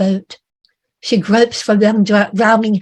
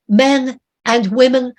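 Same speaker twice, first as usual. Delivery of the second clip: ordinary delivery, nothing marked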